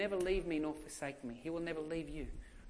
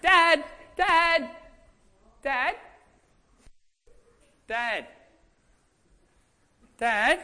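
put-on panicked kids calling; 10 semitones higher, 9 dB louder, much slower than usual. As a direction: call for dad with a rising hint of panic. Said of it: "little bit of panic in their voice".

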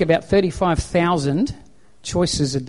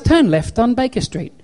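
lightly imitated sat-nav, higher pitch; GPS voice; 7 semitones higher than usual. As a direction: give directions like an unbothered GPS, no shouting. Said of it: "what my G P S does".

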